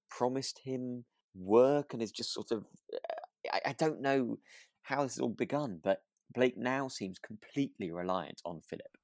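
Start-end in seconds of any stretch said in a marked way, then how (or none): none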